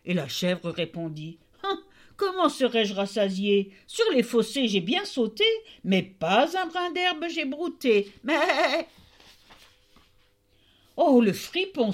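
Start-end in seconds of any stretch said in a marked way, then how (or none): 1.6-8.9 high, sing-song goat voice, rising on each line; a sly goat chanting its complaint; mocking goat. Goat's lying rhyme. 11.0-11.9 loud, higher outraged cry; the tailor fumes at his son; angry tailor. Acted. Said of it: "s'écria le tailleur".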